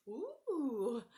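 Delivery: high, loud burst of laughter then ooh; startled laugh. Real, not acted. Egg tipping off the spoon, startled high laugh.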